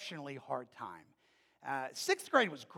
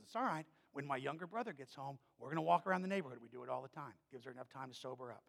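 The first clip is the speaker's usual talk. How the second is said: quiet reassurance; 3 semitones lower, 7 dB quieter, faster than usual. Retold or imitated. Boy reassuring friend quietly.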